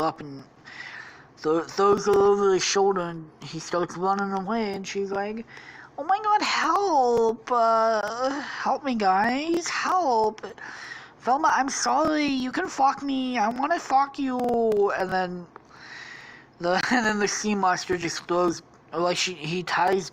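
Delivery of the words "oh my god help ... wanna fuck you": high, whiny damsel in distress; hammed-up high-pitched pleading; pleading Daphne. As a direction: wail for help in a girly voice. Daphne carried off calls help.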